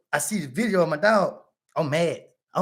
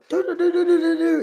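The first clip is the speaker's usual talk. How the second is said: a high, sung "doo, doo, doo" doorbell chime; imitated doorbell; 11 semitones higher, 3 dB louder than usual. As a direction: chime it out like the doorbell that woke him. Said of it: "I hear a doorbell".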